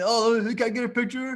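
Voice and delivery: high, mock-eager request; eager picture-seeker. Coach mimicking a guy asking the bartender, mock eager. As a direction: imitate an overkeen guy asking a favor.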